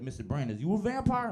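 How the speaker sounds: put-on high, loud kid question; mock eager student. A student asking his teacher if he's a vampire, high and eager. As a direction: pipe up like a curious kid.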